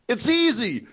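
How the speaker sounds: high-pitched comic urging; hammed-up high eager voice; mock eagerness. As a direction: pitch it high, as if it's simple. Acting out an eager committee member.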